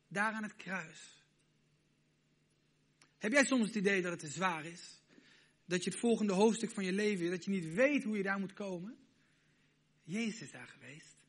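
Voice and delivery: normal talking voice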